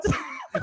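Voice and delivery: breathy burst of laughter. Real, not acted. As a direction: laugh at your own story. Laughs at own story.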